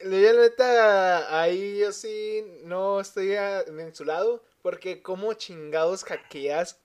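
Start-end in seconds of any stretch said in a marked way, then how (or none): none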